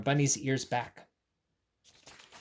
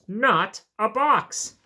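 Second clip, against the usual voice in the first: indignant; 6 semitones higher, 6 dB louder, much slower than usual. Acted. Bunny corrects, read aloud.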